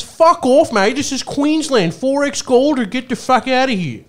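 high, loud mock Aussie bloke telling him off; Australian accent. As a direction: bark it like an outraged Queensland barman. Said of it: "His response was".

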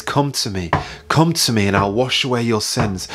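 usual speaking voice

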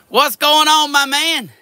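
loud, high hey to a buddy; a loud, high greeting to a favourite animal. Real, not acted. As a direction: call out a big hello.

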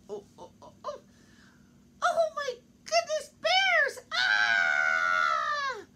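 very high, loud panicked scream; Goldilocks frightened. Acted. Goldilocks waking to find the bears, scared scream. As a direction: shriek in terror at the bears.

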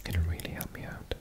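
ordinary talk, nothing marked

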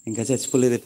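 soft, callous murmur over a sick husband; a wife checking whether her husband has died yet, softly and coldly; cold muttered remark. Retold or imitated. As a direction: say it under your breath, unmoved. Wife checks if husband still breathes.

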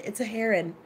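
"heron" as HAIR-un; friend's HAIR-un pronunciation. Retold or imitated. Repeating what the friend said, "heron" as HAIR-un. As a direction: say "heron" with HAIR first, then a weak -un. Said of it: "That's what she said".

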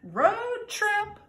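high, loud excited shout; excited cry. Acted. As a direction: call it out with glee, way up high. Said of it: "cried Pete".

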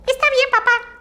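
put-on high, eager little boy voice; young boy replying cheerfully to his dad; child Dazú. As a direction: answer like an obedient small boy, pitched high. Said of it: "dijo Dazú".

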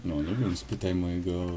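normal talking voice

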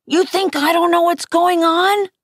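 high-pitched angry challenge; narrated yell. A narrator's reading. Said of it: "I yelled".

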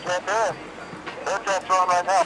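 usual speaking voice

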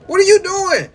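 high, stunned squeal of disbelief; shocked disbelief. Real, not acted. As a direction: freak out at the screen. Shocked at being kissed.

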